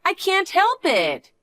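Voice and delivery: high, slow fake-helpless whine; high and slow, not sorry at all; mock innocent. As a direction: play the innocent, drawn out. Niece feigning innocence.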